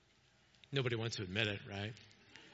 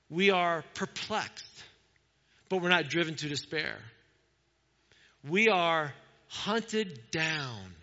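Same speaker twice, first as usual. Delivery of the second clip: slow emphatic reading; slower than usual. Acted.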